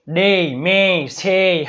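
"ડે, મે, સે" each ending in an added ee glide; mispronunciation demo. Shown as a demonstration. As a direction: say "ડે, મે, સે" as dey, mey, sey. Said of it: "આવું ન થાય હો".

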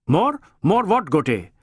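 stern chief growing irritated; impatient superior. A narrator's reading. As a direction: press him for an answer. ACP challenges.

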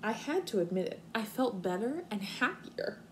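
expressive storybook reading for kindergartners; reading to children. Acted. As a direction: read it out like story time on the rug. Teacher reads picture book aloud.

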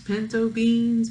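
ordinary delivery, nothing marked

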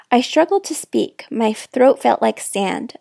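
usual speaking voice

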